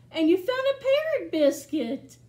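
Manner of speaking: excited storytime reading. Acted. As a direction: delight the kids with the find. Reads picture book aloud.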